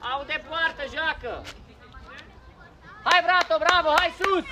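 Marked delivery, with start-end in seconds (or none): 3.1-4.5 loud, higher urging call; cheering shout. Real, not acted. Coach cheers Brato forward, cheering shout. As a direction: yell the player on up the pitch.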